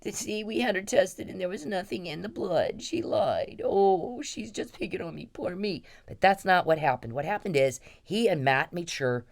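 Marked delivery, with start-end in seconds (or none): 0.0-5.8 higher, whiny mock self-pity; sneering impression of Perry playing the victim; mock poor me. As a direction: mimic a whining victim, sneering. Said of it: "done the".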